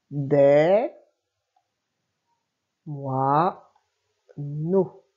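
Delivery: each word read slowly, split in two; lecture de mots. Shown as a demonstration. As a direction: read the words aloud slowly for a child. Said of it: "on essaye de faire ensemble". (mots lus lentement et clairement)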